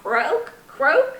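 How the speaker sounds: mock croak with a rising end; croaking frog. Storyteller voicing Frog for children, light croak. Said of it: "said Frog".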